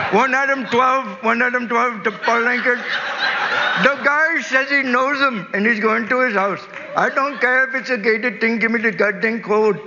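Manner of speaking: high, loud hammed-up cabbie barking into a radio. Cabbie radios dispatch for the gate code, high and loud with laughter. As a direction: play the cab driver shouting at dispatch, cracking up.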